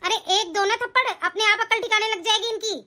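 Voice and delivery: ordinary talk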